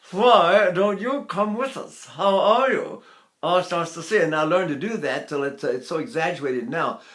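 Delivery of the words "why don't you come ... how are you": slow, high posh stage English. Shown as a demonstration. Teacher mocking high stage English. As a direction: talk like an Old Vic actor. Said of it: "I want to speak like this".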